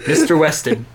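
usual speaking voice

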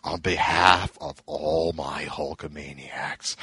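low, slow, depressed wrestler impression; mock-dejected speech, dragged out low; Hulk Hogan. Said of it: "like, so depressed".

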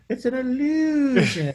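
high, slow, laughing magician's line; mock magician. Friend mocks Doug Henning's tricks, high and slow with a laugh. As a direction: drag it out like a cheesy stage illusionist.

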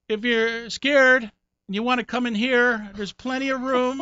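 imitating the roommate inviting the young comic into his bed; sly invitation. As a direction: lay on the come-on innocently. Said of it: "and he goes".

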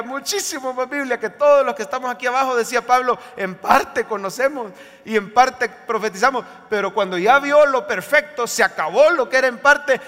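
loud, building sermon delivery; emphatic, driving list from the pulpit; full-force preaching. Real, not acted. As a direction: preach heaven's promise with rising force.

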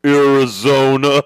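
loud, drawn-out caricature; Tim. Retold or imitated. Comic mimics host answering where he's going, loud slow impression. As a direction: boom out the word slowly as mockery. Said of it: "Tim was like".

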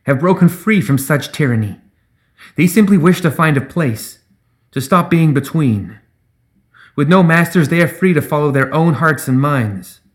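ringing, measured advocacy; high and clear. A narrator's reading. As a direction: plead their case to the crowd. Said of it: "rang out across the square, high and clear".

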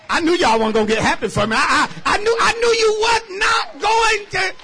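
loud, high, shouting preacher's climax; full force preaching. Acted. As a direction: whip the congregation up with full voice.

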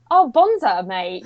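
broad Aussie "bonza, mate"; Australian accent. Retold or imitated. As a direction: slip into an Aussie twang. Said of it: "your Australian accent's amazing".

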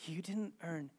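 low, soft, fading to near whisper; hushed correction. Retold or imitated. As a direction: let the rebuke sink almost to nothing. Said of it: "the Holy Spirit says".

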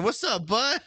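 high, rising mock-friendly hail; cocky greeting. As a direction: pretend to greet a rival cheerfully. Acts out greeting him next time.

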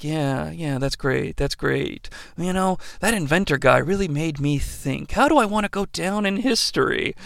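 soft, distracted, far away; spaced-out mumble. Stanley, distracted by his thoughts, answers softly. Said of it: "staring off into space like".

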